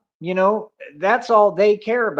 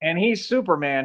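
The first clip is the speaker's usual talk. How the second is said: usual speaking voice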